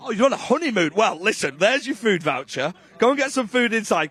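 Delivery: normal talking voice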